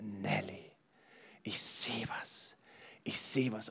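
leise, langsam geflüsterte Stimme Gottes. Pretend. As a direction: wie Gott, der zärtlich zuflüstert. Gott spricht zu Nelly. (geflüsterte Gottesstimme)

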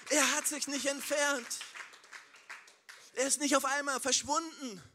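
laut, hoch und eindringlich gepredigt; Predigen mit voller Kraft. Acted. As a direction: predige mit voller Leidenschaft in den Saal. (kraftvolles, lautes Predigen)

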